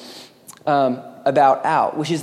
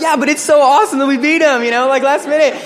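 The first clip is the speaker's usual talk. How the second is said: much higher and louder, thrilled; gushing fan; 9 semitones higher, 6 dB louder, faster than usual. Retold or imitated. Husker fan still raving about the win, excited. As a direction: gush about the last-minute win. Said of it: "They're like".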